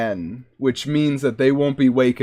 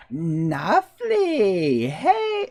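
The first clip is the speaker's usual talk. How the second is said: hammed-up exclamation; 7 semitones higher, much slower than usual. Hammed-up exclamation after voices.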